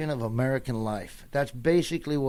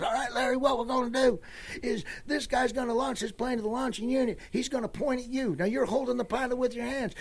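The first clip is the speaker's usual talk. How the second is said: instructor voice; 10 semitones higher, 3 dB quieter than usual. Retold or imitated. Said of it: "And he goes".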